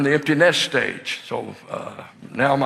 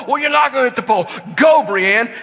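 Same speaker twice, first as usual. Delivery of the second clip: fast, loud cheer, much higher; excited shout; 7 semitones higher, 4 dB louder, faster than usual. Retold or imitated. Dad shouting his daughter off on her bike, excited shout. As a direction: send her off with a yell.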